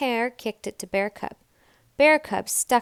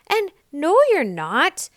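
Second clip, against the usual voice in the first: higher, louder friendly insistence; animals insisting; 8 semitones higher, 8 dB louder than usual. A narrator's reading. Animals tell Nell she isn't too small. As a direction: brush off her doubt.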